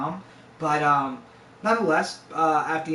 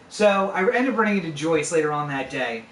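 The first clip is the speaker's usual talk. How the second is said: ordinary talk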